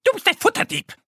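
very high, fast bird scolding. A narrator's reading. Tits and sparrows scold the squirrel thief, high shrieking birds. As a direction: chirp an angry telling-off. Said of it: "die Meisen und Spatzen schimpfen".

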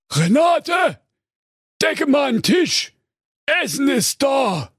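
ogre shouting. Acted. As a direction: call out like a hungry giant. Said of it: "eine furchterregende Stimme rief". (lauter Menschenfresser-Ruf)

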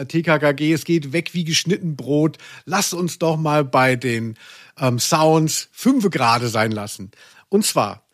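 ordinary delivery

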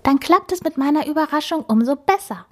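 put-on excited young boar with a secret; scheming wild boar girl. A narrator's reading.

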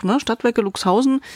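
ordinary talk, nothing marked

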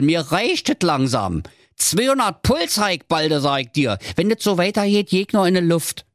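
high, fed-up grumbling creature; irate Blindi. Acted. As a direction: rant as if about to explode.

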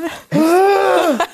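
high, loud theatrical gasp; mock gasp. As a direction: gasp as if scandalised. Mock horror at Q-Tip confession.

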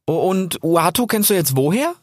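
put-on high young fox voice, curious; young fox asking. A narrator's reading. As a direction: ask again, puzzled. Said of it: "fragte Rix nochmal".

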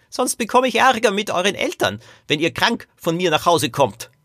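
put-on high-pitched old man's warning; cautioning great-grandfather. Great-grandfather worries the children will go home ill, high cautioning voice.

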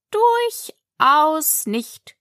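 drawling, dismissive caterpillar; languid caterpillar. Acted. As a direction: split the word and stretch each part. Caterpillar's slow contradiction.

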